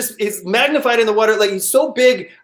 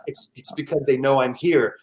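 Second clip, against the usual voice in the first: ordinary delivery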